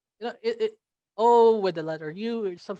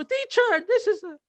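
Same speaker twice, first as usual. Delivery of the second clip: high-pitched imitation of a child; excited young pupil; 14 semitones higher than usual. Child showing teacher a toy mid-lesson, excited pupil. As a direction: pipe up like an eager little pupil.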